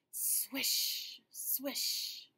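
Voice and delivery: breathy swishing leaves sound; whispered swish. Shown as a demonstration. Teacher showing children the leaf sound, whispered. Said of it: "the sound of the leaves falling on the roof".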